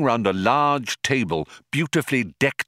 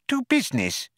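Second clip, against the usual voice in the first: high tiny businesslike mouse; squeaky mouse getting down to business. Acted. Benji mouse voice.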